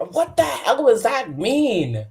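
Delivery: slow, high mock exasperation; exasperated bafflement. Rookie baffled by contradictory advice, exasperated. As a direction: drag it out high, utterly lost.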